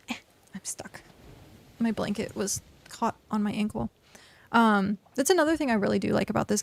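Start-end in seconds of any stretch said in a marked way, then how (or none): 0.1-1.0 whispering. Real, not acted. Tangled in blanket mid-stream.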